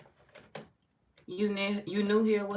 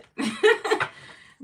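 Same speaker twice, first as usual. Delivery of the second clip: high burst of laughter; 10 dB louder than usual. Real, not acted. Laughing at a viewer comment.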